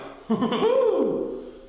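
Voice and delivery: high squeaky cackle; critter giggle. A narrator's reading. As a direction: let out a gleeful giggle. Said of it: "laughed Titter".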